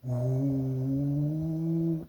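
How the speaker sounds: long, steady wind howl; hollow 'ooh' held on one note; imitated storm wind. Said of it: "you talking about sound like a".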